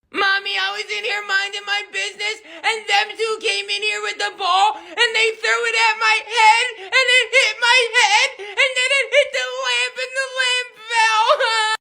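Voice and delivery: put-on high, tearful little boy tattling between sobs; fake-cry your way through the story to Mommy; whiny little Billy. Little Billy tattling to his mother, high whiny fake crying. Billy voiced as whining kid.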